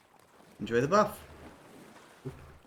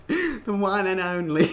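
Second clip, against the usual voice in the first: put-on accent slipping into Australian, laughing; accidental Australian accent; 4 semitones higher than usual. Player losing Pyro's voice, accent turning Australian. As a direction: let the accent drift Australian as you giggle. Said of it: "It's turning Australian".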